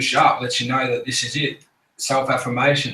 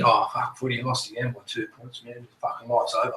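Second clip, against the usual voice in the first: softer, mumbled whinge about losing at footy; mock whiny mates; 6 dB quieter than usual. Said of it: "They're complaining about fucking footy".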